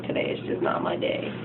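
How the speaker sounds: soft, unvoiced sad mutter; imitating a dejected guy, barely voiced; sad mumble. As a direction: mope it under your breath. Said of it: "He's just so, so sad".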